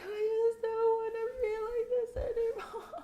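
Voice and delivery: very high, laughing mock wail; hammed-up tearful squeal; imitated crying. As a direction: whine it way up high like a crying baby. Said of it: "I just wanted to cry".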